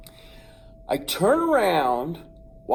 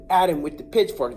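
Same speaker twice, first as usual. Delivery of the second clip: ordinary talk, nothing marked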